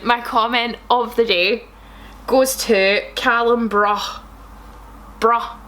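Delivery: ordinary talk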